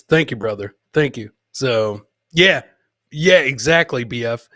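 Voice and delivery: normal talking voice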